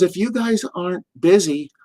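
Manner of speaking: lightly put-on New York accent; Missus Hart. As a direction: invite them over in a New York accent. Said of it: "with that New York accent".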